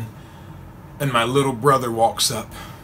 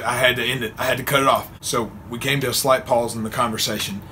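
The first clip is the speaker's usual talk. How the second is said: ordinary talk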